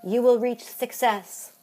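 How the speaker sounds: fortune-teller read. Acted. Reading fortune.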